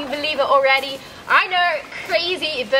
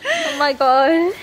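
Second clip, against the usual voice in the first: shivering laugh; 5 dB louder, much slower than usual. Real, not acted. Sitting in cold water.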